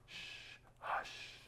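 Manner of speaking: unvoiced "Shh, hush"; a breathy whispered shush. Shown as a demonstration. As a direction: hush them like a sleepy child. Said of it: "Like that, like my voice right now".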